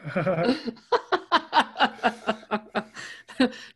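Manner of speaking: high, long laughing fit. Real, not acted. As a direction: burst out laughing. Laughs at pirate bit.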